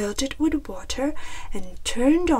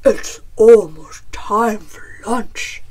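loud, hearty bear voice; eager bear; 2 semitones lower, 10 dB louder than usual. A narrator's reading. Bear tells Chicken lunch is near, loud eager bear. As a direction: announce it as a big hungry bear. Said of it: "Bear said".

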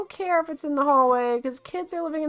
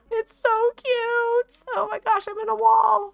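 cooing; 10 semitones higher than usual. Real, not acted. Cooing over a cute ram.

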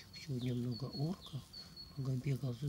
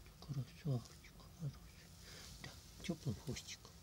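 whispering; 2 semitones higher, 6 dB quieter than usual. Real, not acted. Whispering to squirrel.

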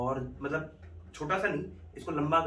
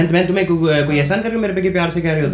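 louder and sharper; mock angry retort; 3 semitones higher, 10 dB louder than usual. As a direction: pick a fight on purpose. Faked quarrel.

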